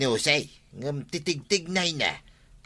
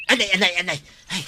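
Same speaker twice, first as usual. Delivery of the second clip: cries of pain; 3 semitones higher, 4 dB louder, much faster than usual. Acted. Old man hurts himself on stone.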